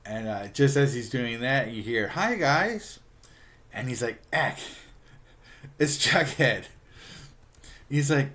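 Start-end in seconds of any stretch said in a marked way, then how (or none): 2.1-3.0 high, sing-out greeting; cheery arrival. Retold or imitated. Jughead turns up in the yard, cheery hello. 4.3-6.6 louder, higher mock alarm; startled. Archie spots Jughead arriving, startled.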